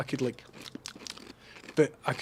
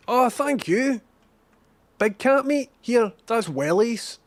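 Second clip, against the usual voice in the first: higher, louder delighted thanks; delighted surprise; 10 semitones higher, 6 dB louder, slower than usual. Real, not acted. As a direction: gush over the gift. Receiving gifts from player.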